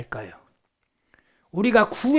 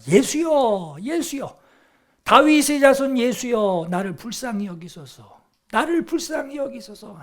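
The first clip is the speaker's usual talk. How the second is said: imitated crying out; 5 semitones higher, 3 dB louder than usual. Blind man calls to passing Jesus, imitated crying out. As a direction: call out to Jesus as the blind beggar. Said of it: "그가 소리 지릅니다".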